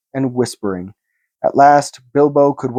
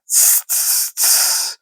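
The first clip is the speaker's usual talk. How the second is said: soft unvoiced tss hiss; creature hissing; 6 dB quieter, much slower than usual. A narrator's reading. Gollum hissing while thinking of the riddle. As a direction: hiss through the teeth like a snake. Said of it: "hissed Gollum".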